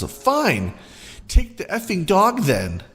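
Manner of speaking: high, huffy mom voice; lightly voiced sulky mother; entitled mom. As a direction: give in with a huff.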